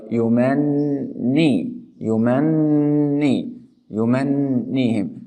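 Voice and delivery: slow, held nasal n in "yumanni"; shadda with ghunna. Shown as a demonstration. Teacher showing shadda, long humming n in "yumanni". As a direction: hum the doubled n of "yumanni" before the "i". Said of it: "There is a shadda".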